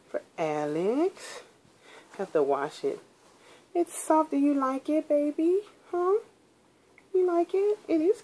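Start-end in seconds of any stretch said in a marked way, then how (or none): 3.7-8.2 very high, sing-song pet voice. Real, not acted. Cooing at the cat.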